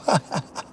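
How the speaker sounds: low, breathless laugh; trailing wheezy laughter. Real, not acted. Amused by the interview he imagined, laughing softly.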